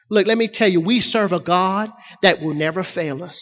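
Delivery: ordinary talk, nothing marked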